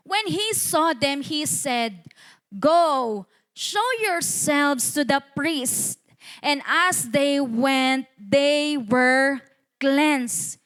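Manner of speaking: slow, spaced-out Bible reading; scripture reading. Acted. Preacher reading verse fourteen to the church, slow declamation. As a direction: read the verse out slowly, word by word.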